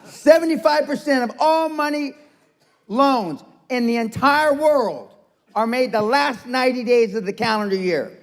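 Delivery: high-pitched, deliberately slow spelling-out; slow, teasing repeat. Shown as a demonstration. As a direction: spell it out slowly as if for slow listeners. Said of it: "I'm gonna say it again slow, 'cause you're Dutch".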